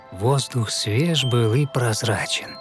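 ordinary delivery

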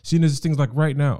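imitating a stressed girlfriend; frantic partner. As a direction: rush it like everything's falling apart. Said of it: "she'll be like".

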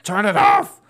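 sharp, high exasperated yell; shout. Retold or imitated. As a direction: yell it across the house at the alarms. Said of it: "and I'm like".

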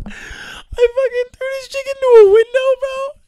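very high, squeaky outburst; shrieked disbelief. Real, not acted. Reliving the window accident in disbelief.

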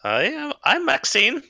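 high, excited mare voice; the old horse perks up at the name; a high-voiced horse startled by a familiar name; talking horse. Horse Maxine speaks.

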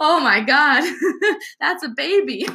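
giggling high, half in awe; amused retelling of first birth, laughing through it; laughing disbelief. Retold or imitated. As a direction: laugh through a stunned nurse reaction.

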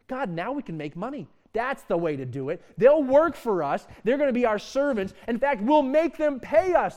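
hammed-up greedy plotting; greedy scheming Israelites. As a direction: gloat over the money plan.

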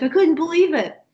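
can't believe his luck; mock amazed old man; excited disbelief. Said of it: "he was really excited".

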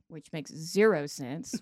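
ordinary talk

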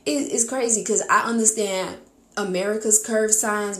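ordinary talk

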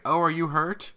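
high, laughing mocking taunt. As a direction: tease like a heel villain, giggling. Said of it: "he's like".

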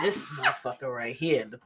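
normal talking voice

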